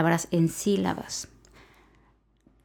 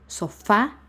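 "Sofá" stressed on FÁ, rising; stress on last syllable; 3 semitones higher than usual. Shown as a demonstration. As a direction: lift the final á of "Sofá". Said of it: "el acento va en la A".

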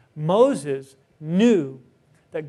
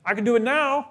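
put-on higher, eager kid suddenly willing; eager little brother; 5 semitones higher, faster than usual. Son who changes his mind eagerly, with mock eagerness. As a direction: jump in like a keen younger son. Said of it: "And be like".

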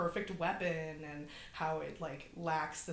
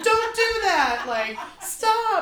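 much higher, much louder, slow hammed-up panicked yelling; Lambert yelling; 15 dB louder, slower than usual. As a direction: shriek it like the scared woman in a horror film. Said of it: "all of her lines are just, like, yelling".

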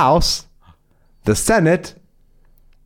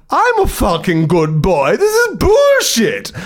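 outraged squeal, much higher; rising to a furious high yelp; hopping mad dog; 12 semitones higher, slower than usual. Acted. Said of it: "this drives Benny hopping mad".

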